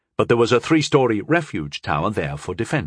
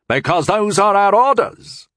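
firm, higher and louder; stern young officer insisting; 9 semitones higher, 4 dB louder than usual. A narrator's reading. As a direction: hold the line like a soldier. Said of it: "Walvin insisted".

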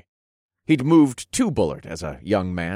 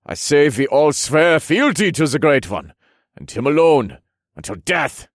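put-on louder, higher, accented-sounding rallying voice; rally the men to an oath; decisive warrior; 7 semitones higher, 6 dB louder than usual. Jorgen calls for an oath.

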